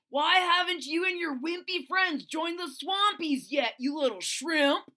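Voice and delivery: high, loud taunting bully voice; bully's jeering shout. Acted. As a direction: yell it like a big mean dinosaur spotting prey. Bully dinosaur Tyrone voiced.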